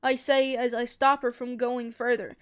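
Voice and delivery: ordinary delivery, nothing marked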